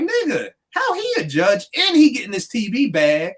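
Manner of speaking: high, put-upon complaint as the snubbed judge; aggrieved judge voice. Retold or imitated. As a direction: whine it like the cheated lawyer. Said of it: "You'd feel some kind of way".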